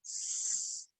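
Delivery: S as a bare hiss with no voice; just hiss the S; letter S said as its soft sound. Shown as a demonstration. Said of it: "soft sound".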